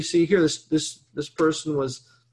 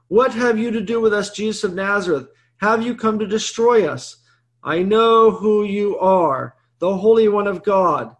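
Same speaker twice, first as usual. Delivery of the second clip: loud, raised cry of the unclean spirit; demon crying out; 4 semitones higher, 6 dB louder than usual. A narrator's reading. Priest reading the possessed man crying at Jesus, loud. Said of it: "and he cried out".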